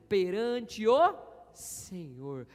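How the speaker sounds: Perante o Senhor stretched very slowly and loud; slow, weighty proclamation of Perante o Senhor; drawn-out emphasis. Acted. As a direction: lean hard on every word of Perante o Senhor. Pastor quotes David emphatically.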